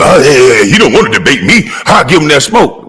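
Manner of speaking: lightly voiced cocky YouTuber; a hint of a boastful challenger brushing him off; mocking YouTuber. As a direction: mimic a YouTuber itching to fight. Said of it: "Tired of hearing you YouTubers".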